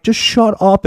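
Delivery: high-pitched mock yell; retold yelling. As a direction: re-enact snapping at someone, voice up. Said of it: "I start yelling at this guy".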